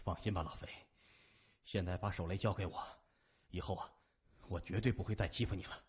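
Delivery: soft, low, fatherly reassurance; a hint of solemn sincerity; earnest and heartfelt. A narrator's reading. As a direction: talk him gently into handing it over. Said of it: "语重心长地说".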